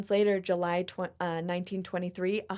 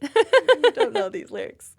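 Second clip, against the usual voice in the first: giggling, higher and louder, slow; laughing; 13 semitones higher, 12 dB louder, slower than usual. Real, not acted. Admitting she forgot the lyrics, laughing. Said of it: "laughing after singing".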